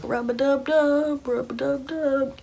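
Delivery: high, drawn-out singsong imitation; mock secret hint. Sir Thomas signalling to the girl, high mock chant.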